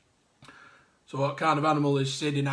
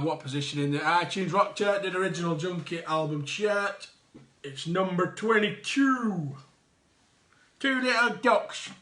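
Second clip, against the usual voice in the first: ordinary delivery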